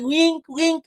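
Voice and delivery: put-on high toddler voice turning "drink" into "wink"; baby-talk "Wink" with the "dr" softened to "w"; childish mispronunciation. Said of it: "she couldn't say the word because she was still a child".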